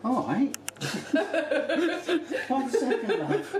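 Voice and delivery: high, laughing through the words. Real, not acted. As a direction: giggle it out. Laughing at wine request.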